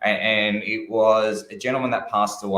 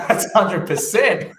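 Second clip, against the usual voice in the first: high, laughing through the words; laughing quote of daughter; 9 semitones higher, 6 dB louder, much slower than usual. Retold or imitated. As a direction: mimic the daughter, breaking into laughter. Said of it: "the daughter's going".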